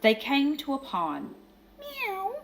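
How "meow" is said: high, pleading cat voices; squeaky little cat chorus begging for water; thirsty meowing cats. A narrator's reading. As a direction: meow up high like a crowd of thirsty kittens. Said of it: "cried the hundreds of cats".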